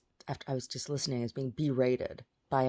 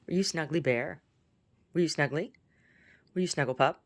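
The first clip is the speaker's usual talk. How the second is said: louder, lilting cooing to a pet; playful pet talk; 3 semitones higher, 6 dB louder than usual. Real, not acted. Owner asks Brody about snuggling with Mama, playful cooing. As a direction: coo teasingly at the pup, voice lifted.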